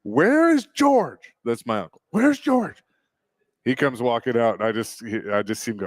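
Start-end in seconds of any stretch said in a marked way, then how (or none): 0.0-1.3 high, slow, outraged grandma; angry grandmother. Retold or imitated. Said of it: "but she goes". 2.1-2.8 high, outraged grandma voice; angry grandmother. Retold or imitated. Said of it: "but she goes".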